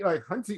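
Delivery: hunty said high and sassy, hun-tee; sassy high-pitched hunty; mock hunty.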